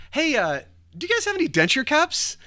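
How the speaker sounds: very high, loud, awkward question; blurted out. Retold or imitated. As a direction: blurt it out to fill an awkward silence. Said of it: "I blurt out the first thing".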